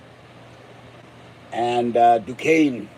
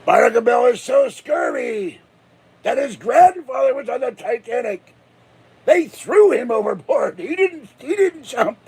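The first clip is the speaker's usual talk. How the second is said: hammed-up pirate tale; 8 semitones higher than usual. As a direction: spin the tall tale like a bragging old sea dog. Tall tale of scurvy pirate.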